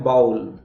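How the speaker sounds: बाउल with a gliding 'au' vowel; बाउल as learners wrongly say it; common mispronunciation. Shown as a demonstration. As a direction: say बाउल with an 'ow' diphthong. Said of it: "तो वो बाउल नहीं होता".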